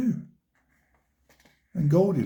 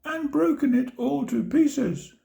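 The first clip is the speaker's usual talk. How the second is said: much higher squeaky little bear; teeny tiny squeak; 11 semitones higher than usual. A narrator's reading. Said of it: "in his teeny tiny voice".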